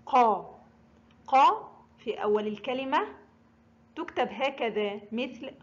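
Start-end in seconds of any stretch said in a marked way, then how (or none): 0.1-0.6 قا sounded out alone, uvular q; قا with fatha. Shown as a demonstration. Teacher showing learners qaf with fatha, قا sounded out. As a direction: say قا with a back-of-throat q and open a. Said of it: "حرف القاف محرك بحركة الفتح ينطق".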